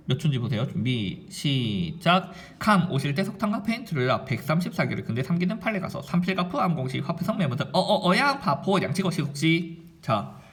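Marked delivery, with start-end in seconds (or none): 0.6-2.3 low, slow starting call; drawn-out countdown. Shown as a demonstration. Teacher cueing viewers to start timing, slowly. 2.6-9.8 timed rapid recitation. Shown as a demonstration. Teacher proving to students how quick memorising is, in rhythmic recitation. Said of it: "몇 초 걸리는지 지금부터 시간을 한번 측정을 해보세요".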